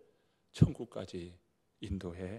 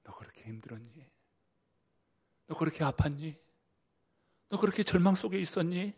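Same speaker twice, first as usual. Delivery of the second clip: gentle questions building louder; tenderly ask, rising to urgency; compassionate Jesus; 6 semitones higher, 7 dB louder than usual. Acted. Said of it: "우리 주님이 말씀하시는 거예요". (주님이 위로하듯 점점 커지며)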